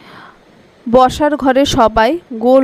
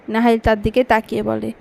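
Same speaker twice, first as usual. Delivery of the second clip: ordinary delivery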